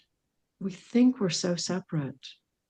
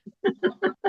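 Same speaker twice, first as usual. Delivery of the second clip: bright, high giggle; high burst of laughter. Real, not acted. Guest laughing at her spider story, high laugh. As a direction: laugh out at your own story.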